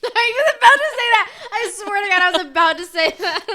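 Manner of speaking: loud laughing exclaim. Real, not acted. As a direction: laugh while insisting. Laughing agreement.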